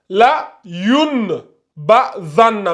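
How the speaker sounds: "la yun ba zanna" slow, with a clear held n; unconverted reading. Shown as a demonstration. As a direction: sound out "yun" apart from "ba". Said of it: "au lieu de lire". (« la yun ba zanna » lentement, n bien net)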